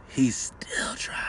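slow whisper; drawn-out whispered interjection; whispered aside. Real, not acted. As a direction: whisper it slowly, as a teasing aside. Teasing aside over the story.

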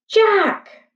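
high, calling call across the brush. A narrator's reading. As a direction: call his name to bring him back. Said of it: "Annie called".

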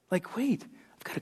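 breathy aside, barely voiced; hushed self-question; whisper. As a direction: whisper it like a sudden thought. Preacher voicing inner doubt.

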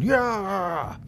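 high drawn-out charging yell; war cry. Acted. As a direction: charge into battle with a long cry. Warriors charge with weapons drawn.

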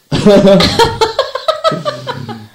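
a high amused laugh; high-pitched laugh. Real, not acted. Laughs at the slow raffle.

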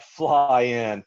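loud, mock-incredulous repeat; incredulous echo. Echoes the question in disbelief.

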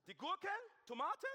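high, blaring questioning yell; shouting over headphones. Acted. Son mishears father in the supermarket, shouting. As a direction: bellow each item as a baffled question. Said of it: "du fängst an zu schreien".

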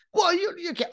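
high mock objection; whiny-pitched player cut short mid-protest; sputtering protest.

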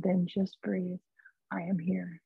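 slow and soft, barely above a murmur; calm soothing. Retold or imitated. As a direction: soothe someone frightened. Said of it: "this voice was very calm".

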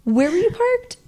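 high, pointed dad question; suspicious dad. Retold or imitated. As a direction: grill her like a doubtful father. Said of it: "And Dad's like".

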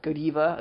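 Godiva said as go-DEE-vuh; Godiva as go plus diva, with an ee; rejected pronunciation. Shown as a demonstration. Host correcting a funny company name, Godiva as go-DEE-vuh. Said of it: "I like Godiva 'cause it's hilarious".

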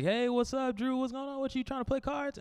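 high, soft imitated kid voice; schoolfriend. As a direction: greet lightly as a young classmate. School friend's greeting, retold.

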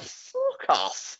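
high, mocking fuck off; mock rude retort. A shop worker snaps back at a customer, high and mocking. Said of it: "And you're like".

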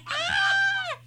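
very high yelped shriek; imitated shriek. Sister mimicking the shriek at the cold towel, very high. Slapping wet towel on legs.

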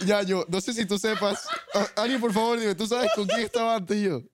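egging the audience on, voice up high; excited, laughing call-out; excited challenge. Real, not acted.

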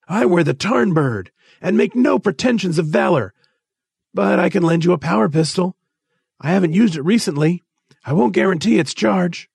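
high-pitched, fussy official; irritable disclaimer in a high voice; testy. A narrator's reading. Rolver excusing himself from the danger, testily. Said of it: "Rolver said testily".

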